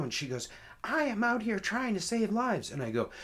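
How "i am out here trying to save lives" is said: much higher-pitched acted indignation; self-righteous protest. Officer defends her work to caller, indignant. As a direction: imitate an affronted officer, voice raised high.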